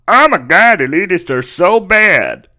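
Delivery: hammed-up indignant impression, swooping pitch; exaggerated mocking imitation; mock politician voice. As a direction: put on a whiny, over-the-top complaint voice. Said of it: "That's literally how she talks".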